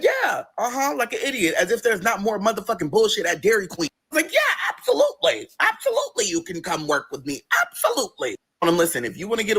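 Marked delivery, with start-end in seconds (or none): 0.0-3.9 mock eager yes. Mocking own eager reply. 4.1-6.3 high, hammed-up eager yes; sing-song mock enthusiasm; mock eager agreement. Mocking own overeager agreement. 6.3-8.4 mock eager yes. Mocking own eager reply.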